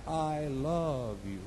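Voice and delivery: soft, drawn-out echo; distant echo. Acted. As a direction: echo it back quietly from afar. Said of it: "The voice came back".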